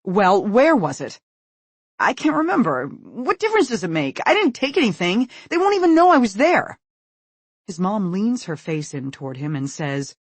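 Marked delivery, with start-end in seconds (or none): 0.0-1.2 pressing demand. A narrator's reading. Mother pressing. 2.0-6.8 high, loud rushed panic; panicked excuses. A narrator's reading. Panicking son.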